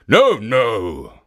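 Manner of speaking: loud gruff ogre refusal; insistent ogre. A narrator's reading.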